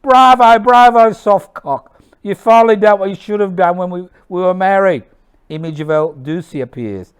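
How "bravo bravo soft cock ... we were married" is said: high, loud jeering shout. Acted. Wife mocking her dead husband, high shouting. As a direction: open at full yell, then scold loudly and quickly. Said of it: "Maria shouts".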